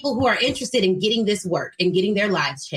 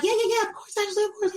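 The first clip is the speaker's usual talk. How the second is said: mock eager agreement; 11 semitones higher, 3 dB quieter, faster than usual. Mimics a company's eager agreement.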